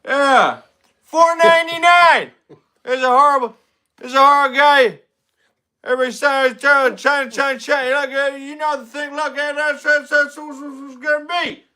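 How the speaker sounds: hammed-up Biden at the debate; Joe Biden impression. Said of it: "It's my Biden wig".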